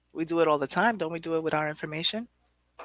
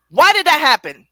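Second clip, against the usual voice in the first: exasperated outburst; 10 semitones higher, 12 dB louder than usual. Pretend. Voicing her frustration.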